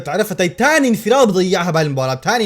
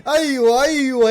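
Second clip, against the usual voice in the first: excited celebrating; 6 semitones higher than usual. Real, not acted. Celebrating the equaliser.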